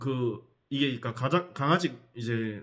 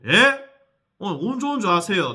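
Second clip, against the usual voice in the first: loud threat; 7 semitones higher, 8 dB louder than usual. Retold or imitated. Narrator threatens the wife.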